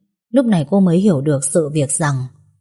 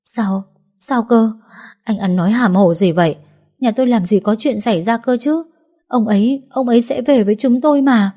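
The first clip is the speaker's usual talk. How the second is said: shocked disbelief; 5 semitones higher than usual. Acted. Widow hears husband is dead.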